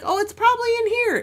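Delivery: mock-confident husband, high, loud and quick; imitating breezy husband. Wife mimics husband about the missing tax return, breezily confident. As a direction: toss it off high and fast as the husband.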